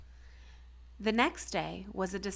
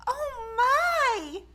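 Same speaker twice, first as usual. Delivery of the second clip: high, loud gasp of alarm from the mother; mother crying out in shock at her striped daughter; 6 dB louder than usual. A narrator's reading. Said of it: "she cried".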